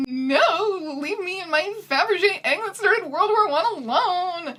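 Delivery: hammed-up whiny villain; whining. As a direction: whine like a spoiled villain. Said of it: "and whining".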